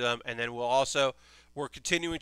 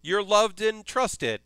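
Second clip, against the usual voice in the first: high, loud, gushing praise; slow, high, loud fond gush; 6 semitones higher, 4 dB louder, slower than usual. Retold or imitated. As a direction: coo it like something adorable. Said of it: "And it's like".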